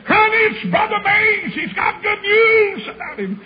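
high, crying shout of joy; mother shrieking with joy into the phone; excited mother on phone. Retold or imitated. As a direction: cry out the good news as the overjoyed mother.

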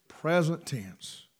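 quiet, slow "Present tense" as an echo; soft echo. Real, not acted. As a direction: let the words settle low. Echoed quietly.

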